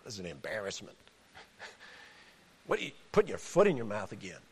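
low, fast scolding aside; tut-tut at Peter like a critic; lightly voiced scolding critic, low and quick; mock rebuke. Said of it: "You say".

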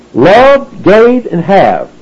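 "Love", "gave", "have" each punched, with "Love" pitched high; emphasis on verbs. Shown as a demonstration. Preacher showing the three emphasized verbs, "Love", "gave", "have" each stressed. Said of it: "Those get the emphasis".

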